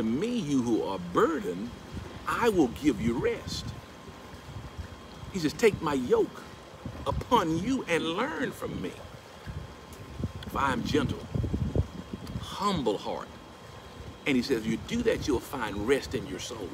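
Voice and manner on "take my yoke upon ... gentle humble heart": usual speaking voice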